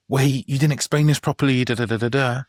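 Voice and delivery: a hammed-up outburst at the doctors; mock shouting. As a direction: pretend to lose it, half-hearted yell. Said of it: "lose my shit and start shouting".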